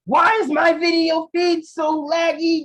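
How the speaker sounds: high, loud, drawn-out wrestler bellow; mock outraged shout. Wrestler griping about the lagging video, mock outraged shout. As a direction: bellow the complaint, hammed up.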